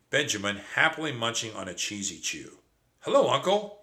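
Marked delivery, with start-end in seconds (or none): 3.0-3.8 high squeaky little mouse voice; squeaky nephew. Acted. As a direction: squeak it like a small kid. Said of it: "he squeaked".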